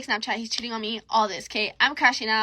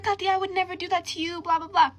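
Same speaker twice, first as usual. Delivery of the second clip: high-pitched, dismissive mimicry of a denial; mocking imitation; 8 semitones higher than usual. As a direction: parrot the excuse in a high mock voice. Mocking friend's denial in a story.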